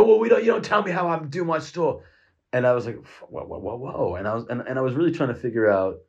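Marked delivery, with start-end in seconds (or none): 0.0-2.0 higher, pushy retort from the owner; the owner shutting the customer down; pitched-up indignant shop owner; indignant shopkeeper. Retold or imitated. Shop owner snaps back. 3.3-4.1 calming. Retold or imitated. Backing off the confrontation.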